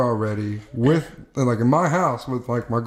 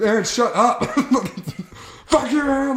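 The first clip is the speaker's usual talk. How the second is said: mock snapping; 10 semitones higher than usual. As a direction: snap it out, high. Retelling snapping at Aaron.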